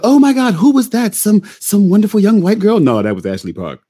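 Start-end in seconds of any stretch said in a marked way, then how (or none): 0.0-2.8 high, loud gushing amazement; amazed audience. Retold or imitated. Imitating listeners amazed by a voice, high and loud. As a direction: squeal at the surprise like a dazzled fan. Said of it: "People go".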